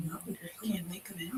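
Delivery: barely audible whisper to oneself; whispered aside. Real, not acted. Trying to recall a book title, whispered to self. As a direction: whisper it under your breath.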